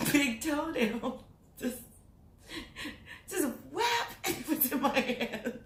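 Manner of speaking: real giggles breaking up high-pitched words; laughing at own joke. Real, not acted. Vlogger telling her toenail story, laughing at her own joke. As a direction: talk helplessly through the laughter. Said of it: "I laugh at my own jokes".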